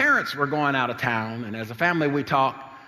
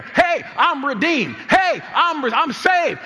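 high, loud, slow proclaiming; preaching at full force; 13 semitones higher, 6 dB louder, slower than usual. Acted. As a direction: declare it to the congregation. Preaching declaration.

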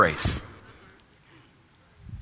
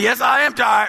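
higher, mildly yelled comeback; mock protest; 4 semitones higher, 4 dB louder than usual. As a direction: shout back in comic protest. Retold shouting back at instructor.

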